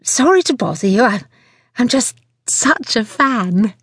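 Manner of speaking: high, coy, gushing voice; simpering fan. A narrator's reading. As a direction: simper at your idol, drawing out the end. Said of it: "transformed into a simpering southern belle".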